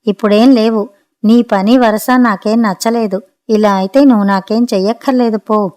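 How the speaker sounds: plain reading voice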